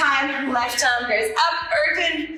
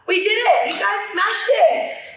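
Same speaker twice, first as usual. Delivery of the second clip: higher, louder cheering; excited cheer; 7 semitones higher, 3 dB louder than usual. Real, not acted. Instructor cheering class for finishing workout, excited.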